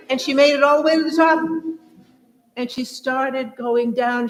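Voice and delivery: loud, fast, laughing cheer at the top; loud laughing celebration as the train crests the mountain; excited triumph. Acted. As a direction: burst out in delighted victory.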